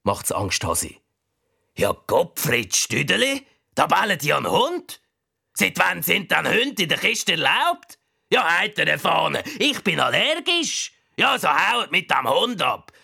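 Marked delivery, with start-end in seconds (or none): 1.8-12.9 higher, fussy complaining neighbour; indignant high-pitched cellmate; grumpy prisoner. Acted. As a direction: rant about the dog in the cell. Prisoner complains about the dog.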